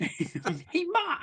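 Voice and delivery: put-on high shouted Boston accent, calling Ma. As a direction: yell for Ma in broad Boston. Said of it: "You can't do a Boston accent".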